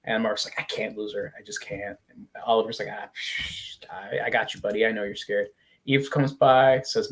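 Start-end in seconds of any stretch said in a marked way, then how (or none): 0.6-2.0 very soft, low, sinking voice; acted despair. Father afraid of losing his daughter, acted quiet despair. 2.9-5.5 soft, low, soothing murmur; acted comforting. Brother comforts a scared father, acted soft reassurance. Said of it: "comforts him".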